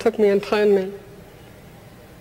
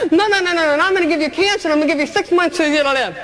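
taunting voice of fate; 7 semitones higher, faster than usual. Retold or imitated. Fate handing her cancer, fast taunting. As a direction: rush the cruel verdict.

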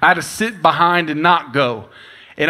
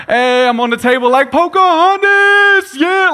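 high, stretched-out shout, much louder; excited kid shout; 13 semitones higher, 6 dB louder, slower than usual. Retold or imitated. A four-year-old standing on the table yelling for Pocahontas, slow high shout.

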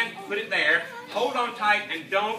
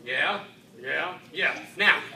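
normal talking voice

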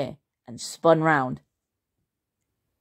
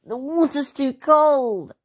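much higher, slow whinge; whinging Henry; 9 semitones higher, slower than usual. A narrator's reading. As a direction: drag out the complaint like a sulky kid. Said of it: "Henry whinged".

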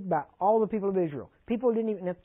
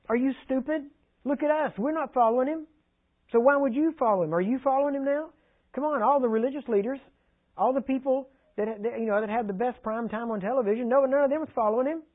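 much higher, sneering; arrogant Pharisees; 7 semitones higher than usual. Acted. Preacher mocking Pharisees' arrogance, high and sneering. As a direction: puff up like a smug religious leader.

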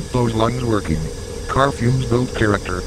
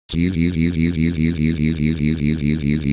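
tag; 4 semitones lower than usual. Acted. Snoring while asleep.

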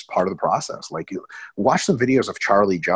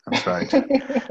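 That's right jumped way up high; mock squeaky agreement. Host repeats co-host's joke in a squeaky high voice.

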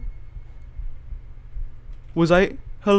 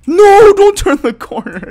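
high, loud, slow scared plea; panicked, drawn-out no; frightened plea; 13 dB louder, slower than usual. Real, not acted. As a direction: beg the game not to make you turn. Dreading the dark corner.

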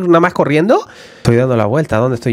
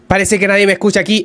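ordinary delivery

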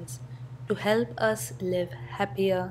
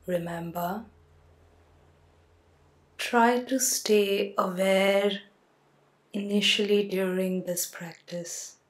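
guided sleep meditation. Acted. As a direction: talk the listener gently down into sleep.

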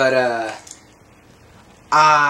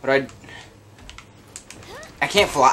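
ordinary delivery

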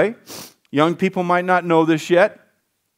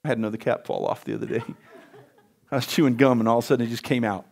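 soft laughing aside; 8 semitones lower, 7 dB quieter, faster than usual. Real, not acted. Personal aside about cap.

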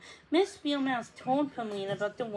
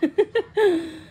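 high, loud chuckle in character; acted laugh; 7 semitones higher, 7 dB louder than usual. A narrator's reading. The swallow after his wound is kissed, laughing. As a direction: laugh out loud as the relieved bird. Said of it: "Giacomo laughed".

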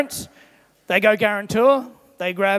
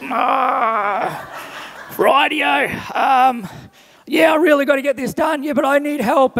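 raised, higher voice, arguing back and forth; mock shouting match; 4 semitones higher than usual. Husband and wife snapping at each other before church, raised voices. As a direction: play the bickering couple, half-shouting. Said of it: "they exchange louder words with each other".

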